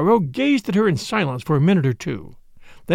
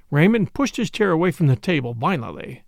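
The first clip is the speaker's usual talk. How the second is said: usual speaking voice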